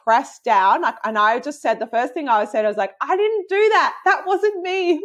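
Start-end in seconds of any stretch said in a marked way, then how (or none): 3.0-5.0 high, startled protest that it wasn't her; lightly replayed high-pitched protest; startled denial. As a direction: squeak out the denial in disbelief. Retold birth, startled denial.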